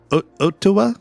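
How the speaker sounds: "Ottawa" high and questioning, in a mock Japanese accent; friend's Japanese-accented "Ottawa". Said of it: "with, like, a Japanese accent".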